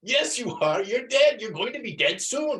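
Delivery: lightly hammed-up arguing; insistent carter. Teacher retelling the skit, mildly hammed-up insistent reply. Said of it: "And he goes".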